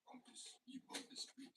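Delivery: very high, soft whispered French sneer; soft mock French retry. Shown as a demonstration. Retrying the joke quietly, faint mock French accent. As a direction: try the French voice again under the breath. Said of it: "Doesn't hit the same".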